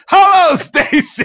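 high, loud, slow sing-song hello; hammed-up greeting. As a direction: greet her like a game show host.

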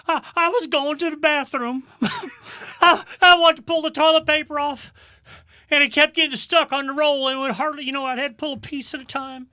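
put-on high, sobbing little boy voice; crying little kid. Retelling how the lost kid sobbed out his story.

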